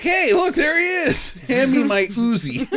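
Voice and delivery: high-pitched goofy alarm, breaking into laughter; mock excited callout. Gang spots Bronson inside the deli, in a high mock-excited shout. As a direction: call it out way up high, hammed-up. Acted gang spotting their target.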